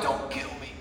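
a soft, struggling plea; terrified plea. Retold or imitated. Pinned under God's presence, begging Jesus in a soft terrified plea. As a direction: barely get the words out in fear. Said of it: "trying to get the words out".

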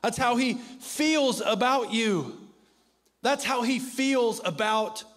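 impassioned preacher crying out; preaching at full force. Acted. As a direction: drive it home to the congregation. Preaching climax on God's love.

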